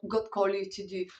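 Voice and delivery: normal talking voice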